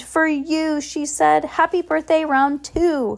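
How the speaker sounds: high, happy announcement; beaming. Acted. Heidi presenting the party to Bruce, beaming. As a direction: present it with a delighted grin. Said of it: "Heidi beamed".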